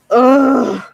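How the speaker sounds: loud, real groan breaking into a cry; frustrated crying groan. Real, not acted. Venting to viewers about being stuck in poverty, with a loud crying groan.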